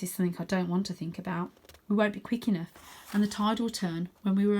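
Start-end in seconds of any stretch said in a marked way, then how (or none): none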